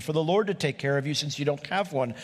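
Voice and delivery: usual speaking voice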